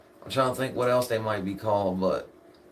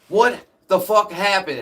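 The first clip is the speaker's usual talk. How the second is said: higher and louder demand; 6 semitones higher, 6 dB louder than usual. Retold or imitated. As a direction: press him for an answer. Retold repeated demand to dad.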